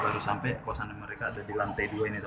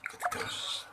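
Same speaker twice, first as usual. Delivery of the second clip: whispering. Real, not acted. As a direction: whisper it to the viewers. Whispered sign-off from the bathroom.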